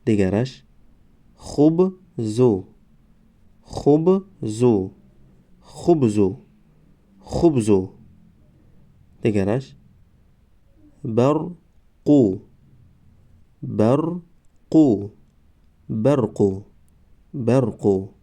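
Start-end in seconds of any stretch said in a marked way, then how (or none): 1.3-4.9 slow, syllables held and separated; slow syllable reading. Shown as a demonstration. Teacher models first readings for learners, slow syllable by syllable. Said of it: "بار یکم و دویم آهسته". 11.0-15.1 slow, syllables held and separated; slow syllable reading. Shown as a demonstration. Teacher models first readings for learners, slow syllable by syllable. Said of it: "بار یکم و دویم آهسته".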